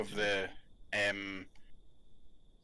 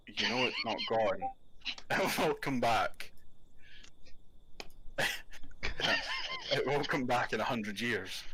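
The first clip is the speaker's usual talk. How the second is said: slow solemn guard; slower than usual. Acted. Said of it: "you see them going".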